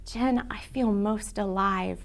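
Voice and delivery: a friend confiding softly on the phone; retold hushed voice. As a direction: confide it like a secret. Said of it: "And she said".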